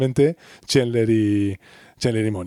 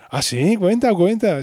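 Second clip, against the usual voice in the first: eager curiosity; 7 semitones higher, slower than usual. Retold or imitated. Friends react to a juicy confession.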